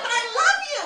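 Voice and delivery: high, pushed protest; mock yelling affection. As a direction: plead your love as if yelling. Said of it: "always think I'm fucking yelling at them".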